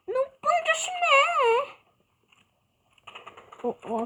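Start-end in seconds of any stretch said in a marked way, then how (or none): 0.1-1.8 slow, high whimpering wail; mock crying. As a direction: wail it out like a crybaby.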